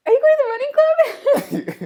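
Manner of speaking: very high, loud overeager question; hammed-up, high-pitched cringey approach; cringey overeager greeting. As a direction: squeal it out like an awkward newcomer. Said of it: "cringe 'cause I was like".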